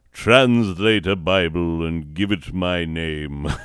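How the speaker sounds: loud, grandiose royal command; pompous sovereign decree. Pretend. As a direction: proclaim it like a self-important monarch. Closes mock sovereign speech.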